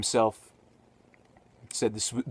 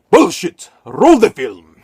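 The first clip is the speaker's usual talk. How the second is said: angry outburst; 5 semitones higher, 16 dB louder, slower than usual. Retold or imitated. Lugosi's outburst on the set.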